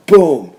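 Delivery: loud impact boom. Retold or imitated. Fan recapping a leap off the top rope, explosive boom. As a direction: punch it like a body landing.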